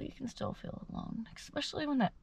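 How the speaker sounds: soft, high, tear-choked voice; holding back tears; tearful. Real, not acted. Vlogger on losing a parent, close to crying.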